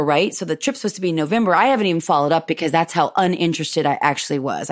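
ordinary talk, nothing marked

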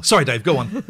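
ordinary delivery

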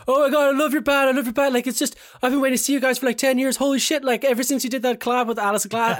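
high-pitched, breathless fan rambling; drunken fan gushing. Retold or imitated. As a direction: imitate yourself gushing at your favourite band.

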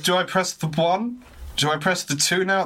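high, slow, helpless question; unsure which button to press; confused. Retold or imitated. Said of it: "And it's like".

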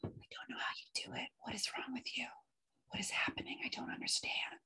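hushed, breathless whisper of disbelief; incredulous whisper to herself. Retold or imitated. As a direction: whisper it like a private aside. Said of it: "And I was like".

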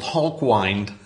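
Hawkwind with "wind" rhyming with "kind"; long "eye" vowel in the second half of Hawkwind; mispronounced band name. Retold or imitated. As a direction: say Hawkwind as "hawk-wined". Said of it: "mispronounced rock legends".

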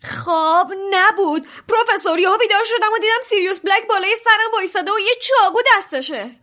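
high, loud, fast cry; shouting. A narrator's reading. Ron insisting to the professor, loud shout. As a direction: shout it out, desperate. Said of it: "رون فریاد زد".

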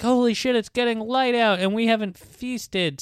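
high, urgent imitated realization; mock alarm. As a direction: play characters suddenly short of time. Acting out vampires noticing dawn.

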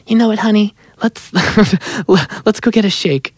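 very high imitation of the co-writer; Julie's voice. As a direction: sweet-talk in her high voice, with a laugh. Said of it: "She talks like this".

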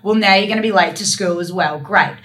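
a hint of an exasperated mum; lightly voiced mum. Mum to teen getting home late, lightly imitated scolding. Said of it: "my mum would be like".

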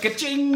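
high, ringing ka-ching; cash register sound effect. Pretend. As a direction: chime it like a till. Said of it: "get a sound effect for that".

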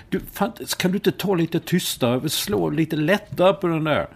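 annoyed retort to seatmate. Retold or imitated. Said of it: "Då vänder jag mig så här".

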